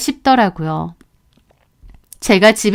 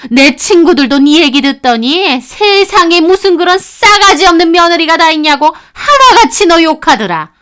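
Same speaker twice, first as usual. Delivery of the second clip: higher, louder tirade; ranting mother-in-law; 7 semitones higher, 5 dB louder than usual. Acted. Mother-in-law ranting at daughter-in-law, scornful. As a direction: pour out every grievance.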